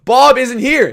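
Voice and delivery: loud, high mock exasperation; hammed-up telling-off at the door; exasperated retort. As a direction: throw it out loud and fed up. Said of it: "I didn't exactly say that".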